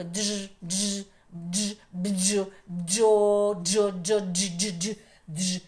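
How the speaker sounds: repeated дж bursts swelling into a loud бджо; buzzing like a bee. Shown as a demonstration. Teacher has pupils buzz like the little bee, loud repeated дж. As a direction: buzz дж as one voiced sound, over and over. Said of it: "дзижчим, як і наша бджілка".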